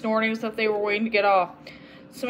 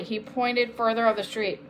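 ordinary delivery

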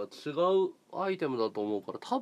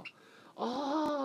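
mock bot voice; 10 semitones higher, 5 dB quieter, faster than usual. Repeats bot's question in its voice.

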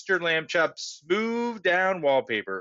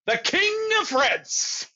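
high, slow, drawn-out mock royal voice; joking mock king; 13 semitones higher, slower than usual. Stanley jokes to the caller that he is royalty, mock-grand. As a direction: pose grandly as a king, pitched way up.

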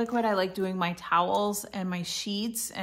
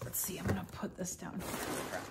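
whispering; 11 dB quieter than usual. Real, not acted. Quiet aside while setting down.

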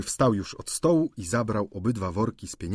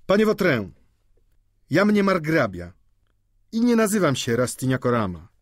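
raised, higher voice, student standing up for himself; indignant student; 7 semitones higher, 6 dB louder than usual. A narrator's reading. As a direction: protest loudly with offended pride. Student angrily challenges Vautrin. (oburzony, podniesiony głos)

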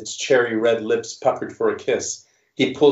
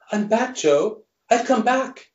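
much higher, coaxing starlet voice; breathy Marilyn act; 12 semitones higher than usual. Acted. Creature insists to Joe it has come back, high coaxing voice. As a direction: sell the comeback like a Hollywood siren.

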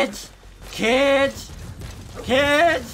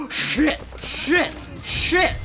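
startled curses; 4 semitones higher, much slower than usual. Real, not acted. Sudden trouble in the game.

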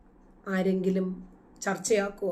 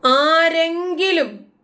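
slow, much higher and louder; drawn-out loud rhetorical call; loud challenge; 7 semitones higher, 6 dB louder, much slower than usual. Real, not acted. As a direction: fling the word out as a challenge.

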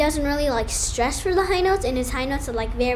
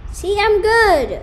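loud proud; 6 semitones higher, 8 dB louder, slower than usual. Real, not acted. Mock triumphant claim, loud slow.

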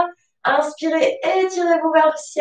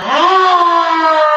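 lion roar; 4 semitones higher, 4 dB louder than usual. Shown as a demonstration. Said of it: "Et on va rugir comme un lion".

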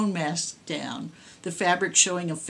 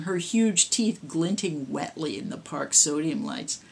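ordinary delivery

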